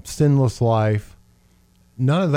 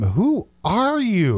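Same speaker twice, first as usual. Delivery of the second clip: high, slow, stretched-out disbelief; taken aback; 7 semitones higher, much slower than usual. Acted. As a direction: act out stunned onlookers, drawing out each word. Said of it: "I can just hear them".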